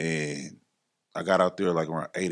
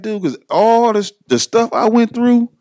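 much higher, louder, rushing pep talk; hyped encouragement; 14 semitones higher, 10 dB louder than usual. Retold or imitated. Johnny pumping up his friend about real estate, loud and high. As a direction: fire him up like a big brother. Johnny hyping him up, retold.

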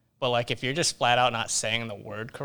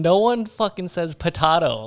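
louder, higher, fed-up retort; exasperated retort; 6 semitones higher, 8 dB louder than usual. Retold or imitated. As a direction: snap it back in disbelief. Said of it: "And I was like".

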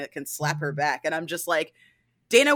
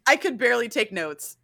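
ordinary delivery

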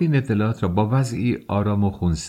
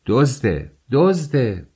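sharp repeated outcry, rising higher on the repeat; crying out; 5 semitones higher, 4 dB louder, much slower than usual. A narrator's reading. Peasant woman accusing a man of theft, crying out. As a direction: call out the accusation, building in pitch. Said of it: "زن دهاتی فریاد زد".

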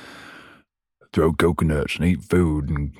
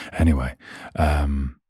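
ordinary delivery

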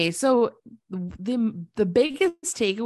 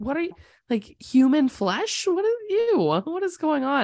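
retold shock, pitch climbing high; mock alarm; 6 semitones higher than usual. Host recounting her horror at the boiling bones joke, mock alarm. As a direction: act out an appalled reaction, voice rising. Acts out own shocked reaction.

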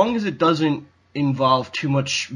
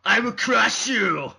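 barbarian; 4 semitones higher than usual. Pretend. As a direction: growl it like a barbarian warrior. Doing Arnold's barbarian line.

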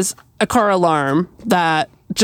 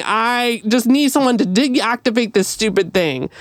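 ordinary talk

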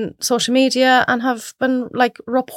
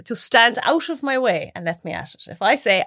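ordinary talk

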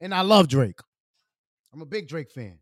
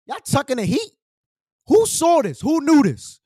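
high, loud indignant questions; loud indignant call-out to the chat; exasperated; 9 semitones higher, 4 dB louder than usual. Real, not acted. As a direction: demand answers from the chat, pitched way up. Mad nobody told him.

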